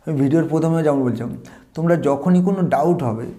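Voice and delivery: usual speaking voice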